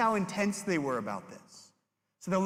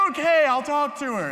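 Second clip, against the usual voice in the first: much higher and louder, giving in; high yelled surrender; 11 semitones higher, 10 dB louder than usual. Retold or imitated. Boy caving while his hair is pulled, high yell. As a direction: cry out that you'll do it.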